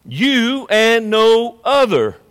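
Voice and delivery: slow, weighty stress on "You" and "no other"; preacher spelling out YOU word by word; emphatic YOU. Real, not acted. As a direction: draw out "You", land firmly on "no other". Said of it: "It, it's emphatic".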